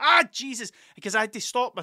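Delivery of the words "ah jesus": high, loud yelp; pained exclamation. Retold or imitated. After hitting his head on the bus, loud high yelp. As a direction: yelp it like you just cracked your head. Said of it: "And I was like".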